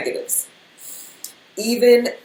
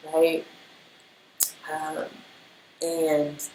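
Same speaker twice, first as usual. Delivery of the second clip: normal talking voice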